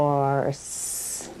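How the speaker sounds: soft, smooth, stretched word; smooth demo word. Shown as a demonstration. Therapist says a smooth word for the child to judge. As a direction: say it smooth and easy. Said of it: "Let's start with this one".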